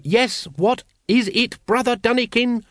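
a hint of a fussy cult leader scolding; pompous Grand Master. A narrator's reading. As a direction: scold the brethren like an irritated chief. Grand Master voiced in story.

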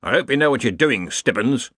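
plain reading voice

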